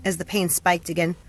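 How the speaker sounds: ordinary delivery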